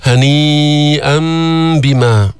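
هنيئا with a long held ي, its final n turned to m; madd and iqlab. Shown as a demonstration. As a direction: chant هنيئا بما, stretching ي, then humming m before ب. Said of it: "جبنا المد المتصل في هنيئا جبنا إقلاب". (مد متصل ثم إقلاب في هنيئا بما)

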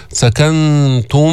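final م of سكنتم held in a nasal hum; slow chant, hum on the end of سكنتم; with ghunna. Shown as a demonstration. Teacher showing the merged meem, سكنتم with held ghunna. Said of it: "مع الغنة".

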